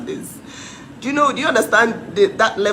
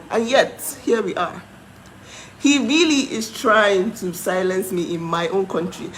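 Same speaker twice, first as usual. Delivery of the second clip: normal talking voice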